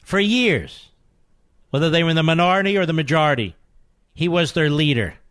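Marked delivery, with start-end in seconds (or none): none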